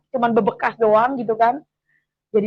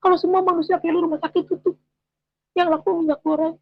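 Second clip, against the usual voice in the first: imitating the husband's scoffing joke; husband mocking; 6 semitones higher than usual. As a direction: tease her like her husband did. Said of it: "Suami saya ngomong gitu".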